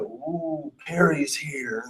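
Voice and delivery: soft, low murmur of anticipation; hushed awed crowd. Acted. Courtroom crowd as the star lawyer walks in, imitated hushed awe. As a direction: imitate onlookers buzzing quietly. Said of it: "and everybody's like".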